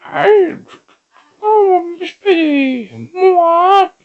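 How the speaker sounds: very high squeaky Kermit voice; high cracking puppet voice, loud; Kermit impression. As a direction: squeal it like a frantic frog puppet. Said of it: "He's like".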